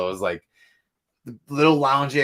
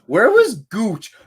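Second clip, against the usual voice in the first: loud, high, slow; 11 semitones higher, 10 dB louder, slower than usual. Real, not acted. Recalling a drunk fan's name.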